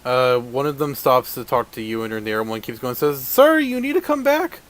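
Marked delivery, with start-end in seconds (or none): 3.3-4.6 high, firm guard voice; guard's demand. Acted. GM as halfling guard ordering player to return.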